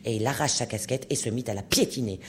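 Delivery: usual speaking voice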